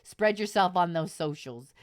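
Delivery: ordinary talk, nothing marked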